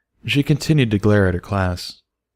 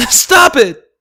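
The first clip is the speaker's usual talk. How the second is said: high piercing shriek, much louder; 10 dB louder than usual. A narrator's reading. Little filly shrieking at her teasing classmates. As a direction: scream it through tears. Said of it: "Dinky shrieked".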